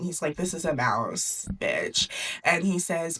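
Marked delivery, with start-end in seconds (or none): 0.4-2.1 low, curt mock-angry king; lightly acted king losing patience, voice dropped; snapping king. King snaps at his daughter over the mouse, low mock anger. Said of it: "snaps in his brain".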